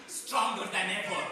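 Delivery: aggrieved guard dog pleading his case; proud, indignant Rottweiler telling his side; Boris. Acted. Rottweiler defends himself to the humans, aggrieved and emphatic.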